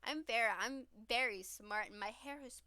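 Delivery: ordinary delivery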